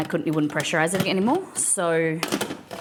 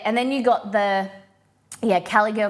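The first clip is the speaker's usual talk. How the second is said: normal talking voice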